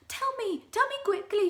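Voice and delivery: high, loud urgent begging; desperate Mrs Twit. Acted. Wife begging her husband, high and urgent. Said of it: "she cried".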